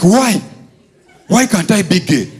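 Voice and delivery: mock indignant young man; annoyed outburst. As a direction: protest as if insulted. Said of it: "Musisi got annoyed".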